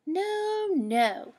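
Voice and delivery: firm motherly no, no; mother rabbit shutting down the idea; mother says no. Acted.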